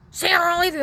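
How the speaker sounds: very high, loud re-enacted threat; shouted threat. Man threatening to leave speaker there, shouting. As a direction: yell it as an ultimatum.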